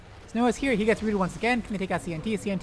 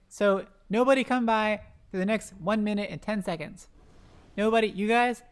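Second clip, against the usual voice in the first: ordinary talk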